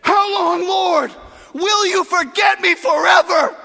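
high, loud desperate cry; impassioned lament at full voice; anguished shout to God. Shown as a demonstration. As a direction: shout your complaint up to heaven. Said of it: "David didn't say it like that. He said".

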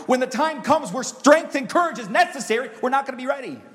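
high, loud climactic preaching; rising sermon climax, high and loud; preaching at full force. Acted. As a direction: build to a shouting crescendo.

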